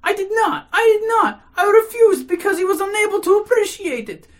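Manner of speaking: high, loud, indignant outpouring; agitated protest. A narrator's reading. Lady denying she gave him money, agitated.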